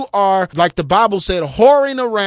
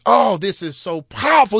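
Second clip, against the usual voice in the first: normal talking voice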